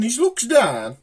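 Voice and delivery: ordinary delivery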